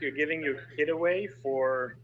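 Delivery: ordinary delivery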